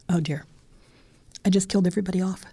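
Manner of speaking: ordinary delivery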